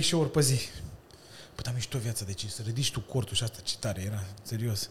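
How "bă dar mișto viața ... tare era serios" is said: low, quiet, understated enthusiasm; sincere but deadpan. Real, not acted. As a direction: praise it softly and low, so it could pass for irony. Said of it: "Nu ești ironic". (entuziasm reținut, spus încet și jos)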